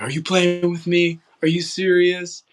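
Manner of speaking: high, loud, drawn-out incredulous questions; Coach Brumfield, excited disbelief. Retold or imitated. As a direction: imitate an astonished coach, slow and high. Acting out coach's reaction to commitment.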